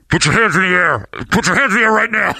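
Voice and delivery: high, loud imitation of an officer yelling; shouted police command. Officer ordering him in the dark, imitated in a full shout. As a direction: yell it as a startling order.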